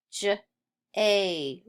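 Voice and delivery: "j" alone, a pause, then "a"; sounded out. Shown as a demonstration. Teacher sounding out J for pupils, sounded out. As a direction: split the j sound from a long A.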